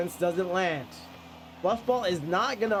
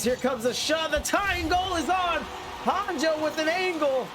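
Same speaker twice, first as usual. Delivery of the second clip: rising play-by-play call, much higher; excited; 8 semitones higher than usual. Real, not acted. Goal chance in close game.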